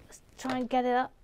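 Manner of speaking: ordinary talk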